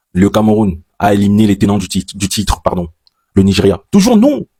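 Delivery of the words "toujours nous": proud high cry of toujours nous; a sudden proud yelp of joy; excited boast. Real, not acted. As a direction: crow it out, pitched high with pride. Repeats the proud claim, pitched up.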